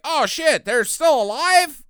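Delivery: high, startled mock disbelief; mock surprise. Telling a movie scene, voices the shocked villain. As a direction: squeak it out in shock. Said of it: "he's like".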